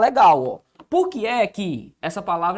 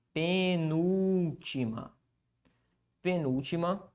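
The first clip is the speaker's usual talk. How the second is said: slow, soft, stretched-out repetition; dictating; 3 semitones lower, 9 dB quieter, much slower than usual. Real, not acted. Teacher writing the definition, slow dictation. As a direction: draw the word out as you write. Repeating word while writing it.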